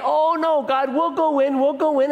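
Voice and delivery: hammed-up panicky begging; pleading grumblers. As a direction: beg like a people caught out, whining. Israelites pleading after God's refusal.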